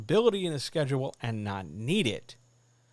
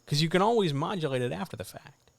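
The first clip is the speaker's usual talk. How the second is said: ordinary delivery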